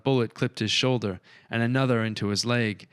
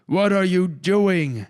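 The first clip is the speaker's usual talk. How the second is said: loud, much higher, slow, stretched yell; 10 semitones higher, 7 dB louder, slower than usual. A narrator's reading. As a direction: bellow it in disbelief. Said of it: "yelled Reginald".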